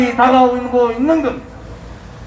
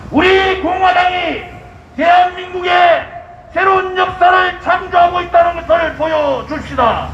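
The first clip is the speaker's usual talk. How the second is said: roaring rallying speech; 4 semitones higher than usual. Acted.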